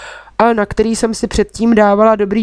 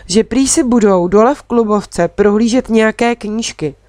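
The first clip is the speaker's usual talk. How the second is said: usual speaking voice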